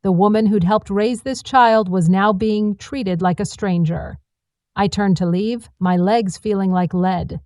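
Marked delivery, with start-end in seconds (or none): none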